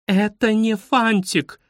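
high, slow, emphatic outraged cry; mild wail. A narrator's reading. Guide loses patience with pupils, wailing protest. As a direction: cry out in exasperation, stretching the words. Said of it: "завопила экскурсовод".

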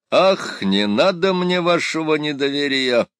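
high, loud hammy exclamation; theatrical exclamation. A narrator's reading. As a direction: declaim it like a stage actor. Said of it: "театрально воскликнул Пыркин".

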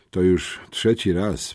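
ordinary delivery

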